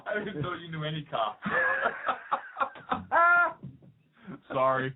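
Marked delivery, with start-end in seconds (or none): 1.5-3.7 high-pitched burst of laughter. Real, not acted. Host after teasing partner about cops, laughing hard. Laughing at own cop joke.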